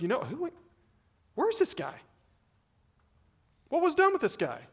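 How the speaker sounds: king asking high and startled; a king suddenly alarmed at an oversight; surprised king. Acted. Preacher voicing the king demanding news of Mordecai.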